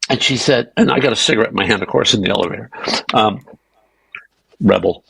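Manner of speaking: ordinary talk, nothing marked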